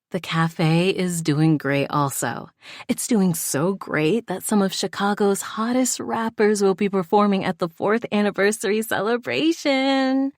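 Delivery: animated, pitch climbing high as it goes; animated announcement. A narrator's reading. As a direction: announce the great news with mounting excitement. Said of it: "London animatedly announced".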